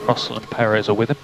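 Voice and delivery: usual speaking voice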